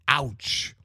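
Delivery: a quick hammed-up cry as the nose is yanked; mock yell of pain. A narrator's reading. The monk yelps when the master yanks his nose, a mild mock ouch. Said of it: "yelled the monk".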